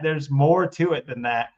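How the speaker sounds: ordinary talk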